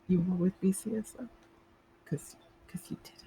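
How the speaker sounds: voiceless, conspiratorial whisper; teasing whisper. Acted. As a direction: hiss it like a knowing secret. Teasing accusation about the accent.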